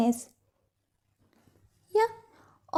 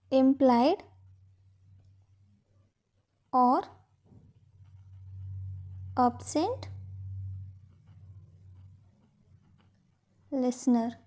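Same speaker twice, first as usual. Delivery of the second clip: each word alone, long gaps between; measured dictation for note-takers; 3 semitones lower, 9 dB quieter than usual. Shown as a demonstration. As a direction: dictate word by word, getting softer. Dictates the same phrase in pieces.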